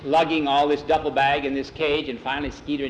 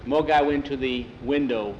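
ordinary delivery

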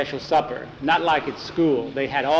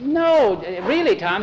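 insisting; 6 semitones higher, slower than usual. Acted. Boy insisting, high.

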